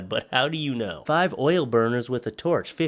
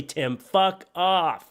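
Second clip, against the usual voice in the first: slow dramatic; 8 semitones higher, much slower than usual. Acted. Character snaps in the skit.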